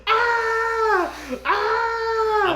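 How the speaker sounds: high loud imitated screams; mock panicked screaming. Danny screaming in a panic attack, high imitated screams. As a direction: scream like she's losing it. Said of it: "And she's yelling".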